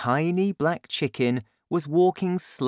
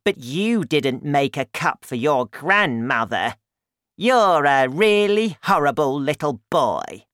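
loud cranky granny voice; loud, indignant old woman; Grandma, scolding; 3 semitones higher, 6 dB louder than usual. A narrator's reading. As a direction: tell the boy off as his grandmother. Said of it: "she cried".

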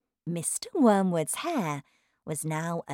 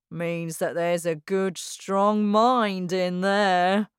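slow, pompous boasting; proud father voice; slower than usual. Acted. Father boasting about his mind, slow and smug. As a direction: drawl it out like a smug dad. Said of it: "he often said".

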